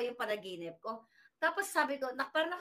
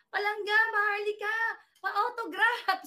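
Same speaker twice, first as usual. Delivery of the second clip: higher, louder excited fan plea; gushing fan; 7 semitones higher, 6 dB louder than usual. Retold or imitated. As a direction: squeal it like a starstruck follower. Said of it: "merong follower na nagsabi".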